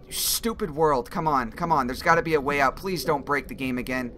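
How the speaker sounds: ordinary talk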